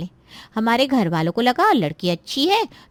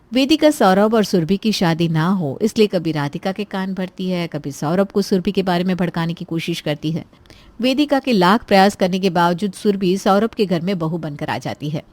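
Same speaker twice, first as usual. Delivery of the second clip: lower, steady storyteller voice; story narration; 6 semitones lower, 3 dB louder than usual. A narrator's reading. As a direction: narrate the scene calmly from outside. Narrator links the scenes.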